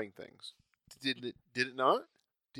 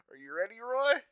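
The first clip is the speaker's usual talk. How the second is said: teasing, rising to a high Roy; egging him on with a grin; playful movie line; 9 semitones higher, 3 dB louder than usual. Retold or imitated. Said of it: "Burt Reynolds references".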